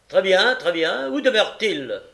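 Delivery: loud, high magistrate; imperious questioning. A narrator's reading. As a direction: press on with the interrogation loudly. Magistrate interrogates impatiently.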